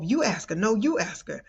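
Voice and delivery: ordinary talk, nothing marked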